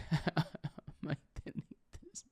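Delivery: unvoiced whisper after a laugh; hushed, sheepish whisper; whispered aside. Real, not acted. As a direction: whisper it like a guilty confession.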